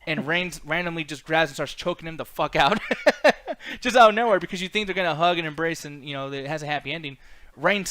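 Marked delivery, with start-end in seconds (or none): 2.8-3.8 burst of laughter. Real, not acted. Laughing at the choke twist.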